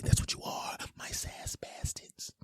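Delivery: slow, intimate whisper. Acted. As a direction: breathe it out like a secret. Drops to a whisper for the listeners.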